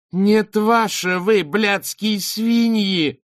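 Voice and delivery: very slow, high, loud raging insult; screaming slow with fury; furious outburst. Acted. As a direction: spit the curse at them. The girl explodes at the judges.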